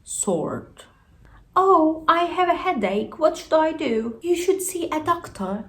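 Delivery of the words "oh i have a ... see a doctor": two lightly voiced speakers, higher; acted dialogue. Teacher acts a headache patient and friend. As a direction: play a little headache dialogue.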